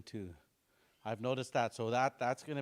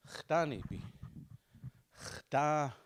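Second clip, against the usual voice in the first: ordinary talk, nothing marked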